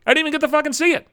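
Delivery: fast, high-pitched aggrieved outburst; indignant complaint. Acted. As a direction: rush it out, voice shooting up. Indignant at missing the ceremony.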